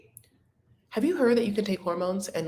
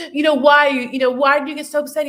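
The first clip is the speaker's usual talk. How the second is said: higher and much louder, imitating an exasperated demand; sharp, outraged mock challenge; mock indignant; 6 semitones higher, 8 dB louder than usual. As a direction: act out her accusing question. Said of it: "and she's like".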